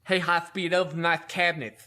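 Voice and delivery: thick, exaggerated lisp on the s sounds; bad lisp. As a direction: imitate a chief with a heavy lisp. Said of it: "talked with a really bad lisp".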